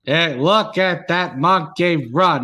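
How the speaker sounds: sportscaster calling a run in a measured, punched cadence; old-time play-by-play voice, hitting each phrase; Howard Cosell. Acted. Mock broadcaster calling the game for friends, Howard Cosell impression. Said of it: "start with Howard Cosell".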